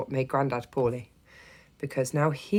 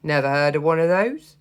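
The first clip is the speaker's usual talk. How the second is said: put-on loud dismissive old man; gruff grandad; 8 dB louder than usual. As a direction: bark it like a doubtful grandad.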